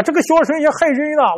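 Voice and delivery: high, falling grumble with 个 stressed; an old man telling his wife he was cheated, angry; angry complaint. Acted. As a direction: blame the fortune teller bitterly.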